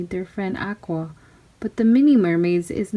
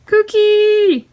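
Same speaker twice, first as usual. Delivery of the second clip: much higher, louder squawk; parrot screech; 13 semitones higher, 6 dB louder than usual. Acted. Parrot on her shoulder, shrieking parrot squawk. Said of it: "it screamed".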